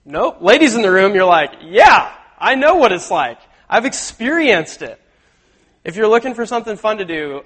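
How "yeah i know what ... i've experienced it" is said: louder, higher voicing of knowing women; imitated indignant "been there" reply; emphatic knowing retort. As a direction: answer emphatically as someone who's been through it. Women retort from their own experience.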